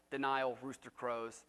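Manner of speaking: ordinary delivery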